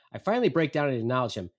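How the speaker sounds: ordinary delivery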